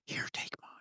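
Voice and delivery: hushed whisper, barely there. Retold or imitated. Henry whispering to Mark in a silent auditorium. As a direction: whisper it so nobody turns round. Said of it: "everyone is, like, very quiet".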